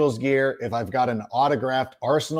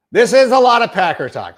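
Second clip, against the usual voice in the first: "This is a lot of Packer talk" said higher and louder, mock exasperated; louder repeat; 7 semitones higher, 8 dB louder than usual. Real, not acted. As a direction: raise "Packer talk" in loud self-mocking protest. Repeats line, second louder.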